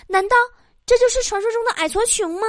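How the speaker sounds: high mock-incredulous female voice; woman on blind date. Woman to her penniless blind date, high mock-incredulous voice. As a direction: put on a high, scornful woman's voice. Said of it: "女的说".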